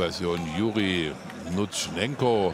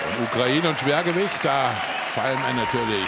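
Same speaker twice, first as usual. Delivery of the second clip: high, louder excited commentary; 7 semitones higher, 4 dB louder than usual. Real, not acted. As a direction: burst in with excitement.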